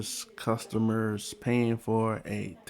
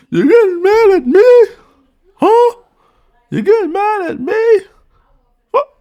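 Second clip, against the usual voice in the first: much higher and louder mock whine; mocking squeal; 14 dB louder than usual. Reaction host parodying the owner yelling at customer, shrill mock squeal.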